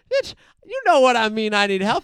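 high, loud comic snap; hammed-up frustrated old man yelling; mock exasperated shout. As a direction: blurt it out, fed up. Old man's exasperated retort.

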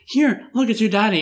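high, bright-pitched mock mom voice; mother to toddler. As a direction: imitate a mom coaxing her toddler, pitched well up. Mother presents father to toddler.

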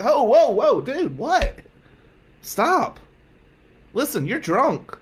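high-pitched, incredulous pushback; alarmed protest. Retold or imitated. Student to drunk mentor who wants to share bed, alarmed. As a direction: cut him off in startled disbelief.

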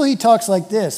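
open, ordinary-volume voice; public way of talking. Acted. How he talks with some people, open public manner. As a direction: say it up front, for company. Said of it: "with some people".

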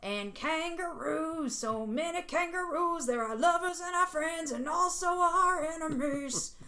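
high, slow, put-on Australian lilt; mock Australian accent. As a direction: keep the silly Australian bit going. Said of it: "we're super Australian".

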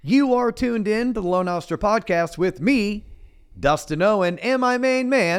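slow booming ring announcer intro; grand entrance announcement. Acted. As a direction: hype it up like a boxing announcer. Podcast intro hype announcement.

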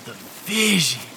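usual speaking voice

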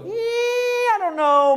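very high, dismissive mock hesitation; dismissive church member. Church member turning away the outsider, mocking. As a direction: brush them off with fake reluctance.

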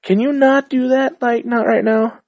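begging his thoughts to stop in a high voice; pleading to his own brain. Retold or imitated. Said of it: "I'm like".

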